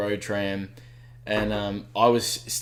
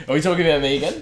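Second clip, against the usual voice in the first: laughing question, fast and louder; 5 semitones higher, 4 dB louder, much faster than usual. Real, not acted. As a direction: laugh it out quickly. Laughs while asking.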